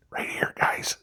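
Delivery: whispered tip to the viewers; whispering to his audience. Real, not acted. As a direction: lean in and whisper it.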